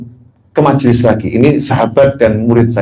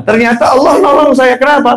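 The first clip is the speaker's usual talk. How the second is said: raised emphatic preaching; 12 semitones higher than usual. Real, not acted. Rhetorical build-up to sholawat point.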